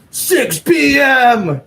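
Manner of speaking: slow, high, loud furious bark; hammed-up girlfriend yelling the time; angry shout. Girlfriend snapping the time at her just-woken partner, angry. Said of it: "Like, pure anger and hate".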